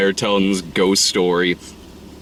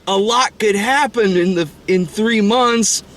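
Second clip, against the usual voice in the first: put-on high, protesting kid voice; seventh-grade boy impression; 11 semitones higher than usual. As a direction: plead like a preteen boy, pitched up. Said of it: "they're, like, in seventh grade".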